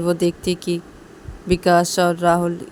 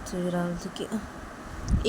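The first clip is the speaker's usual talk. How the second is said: ordinary delivery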